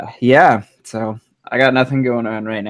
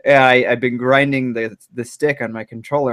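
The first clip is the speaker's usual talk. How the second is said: usual speaking voice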